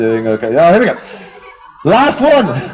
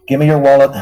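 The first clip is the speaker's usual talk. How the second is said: New York mugger; 7 semitones lower, much faster than usual. Acted. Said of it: "juggling in New York like this".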